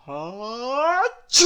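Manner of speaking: big theatrical achoo; sneeze. Acted. Sneeze in story read aloud.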